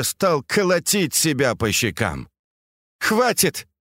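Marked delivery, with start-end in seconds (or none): 3.0-3.7 high-pitched, curt halt; shouted command. A narrator's reading. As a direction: cut it off with a high cry. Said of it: "крикнула Фэнзе".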